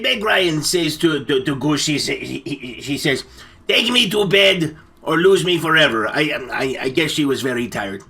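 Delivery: usual speaking voice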